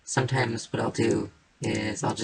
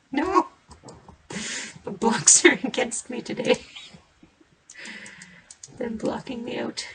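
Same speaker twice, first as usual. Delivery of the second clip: high, louder hammed-up grumble; mock complaint; 11 semitones higher, 4 dB louder than usual. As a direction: pretend the blocks are ganging up.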